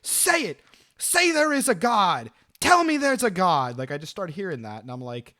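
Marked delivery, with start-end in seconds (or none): 0.0-3.8 high, loud imitation of an enraged demand; imitated screaming, pitched up and forceful; retold scream. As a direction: mimic a man yelling a demand through a wall. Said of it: "I start hearing the guy screaming".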